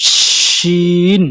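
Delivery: a long, stretched "শিন" with full-mouth air; teacher's slow demo of "শিন"; drawn-out shin. Shown as a demonstration. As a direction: hold the "শিন" hiss long and open. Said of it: "মুখ ভরিয়া বাতাস বাইর হবে".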